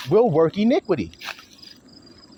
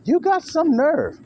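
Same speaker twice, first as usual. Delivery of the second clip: high, slow indignant "You got some nerve"; indignant quote; 11 semitones higher, slower than usual. Retold or imitated. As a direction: throw the accusation back at them. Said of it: "And you're saying".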